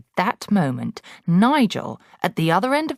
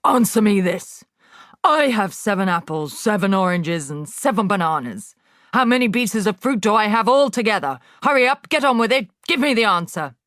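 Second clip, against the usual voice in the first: loud, higher, impatient grilling; bullying headmistress; 5 semitones higher, 5 dB louder than usual. Acted. Headmistress grilling Wilfred, loud and impatient. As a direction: rush him, fast at the end.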